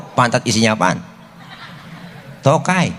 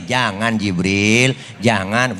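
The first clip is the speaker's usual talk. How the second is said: calm restraint; 7 semitones lower, much slower than usual. Retold or imitated. Prophet calmly restrains Jibril.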